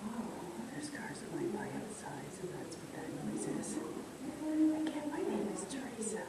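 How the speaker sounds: very soft, breathy whisper; hushed, barely voiced murmur. Real, not acted. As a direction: whisper a note for the recorder. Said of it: "Are you whispering in this one".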